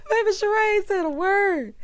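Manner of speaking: high shrieking laugh; loud high laugh spilling into the words; laughing hard. Real, not acted. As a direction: crack up, then speak through it. Laughing at Sheree's silence.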